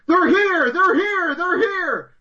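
high-pitched mock scream; imitated screaming. Co-host mocking the worst acting of the year, screaming. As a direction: scream it in a hammed-up panic.

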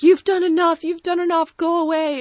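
high, loud hammed-up plea to go away; wife tells her husband's brother to leave, hammed-up; mock tearful wife. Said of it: "Billy's wife is like".

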